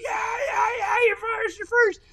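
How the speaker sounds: high cheering yell, voice cracking with joy; excited shouting. Retold or imitated. Dad yelling to his son racing past first, a high excited shout. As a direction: scream it out like a dad losing it at the track. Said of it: "I'm freaking out".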